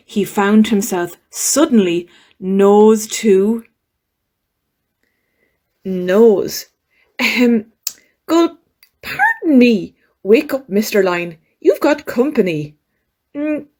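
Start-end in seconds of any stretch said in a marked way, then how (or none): none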